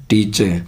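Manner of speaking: "टीचे" ending with no r; correct pronunciation. Shown as a demonstration. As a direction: end "टीचे" on an open vowel. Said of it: "नहीं उच्चारण होता".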